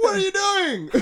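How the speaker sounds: high, loud exasperated cry; high loud mock exasperation; mock outrage. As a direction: yelp it in disbelief. Outraged at brother's purchase.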